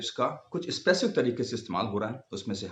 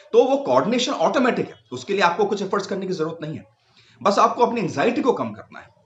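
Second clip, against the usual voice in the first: emphatic teaching; 8 semitones higher, 5 dB louder than usual. Acted. Teacher stresses key point.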